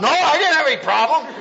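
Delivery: high, quick, defensive; husband defensively brushing off wife; defensive denial. Retold or imitated. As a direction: deny it too fast. Husband denying problem.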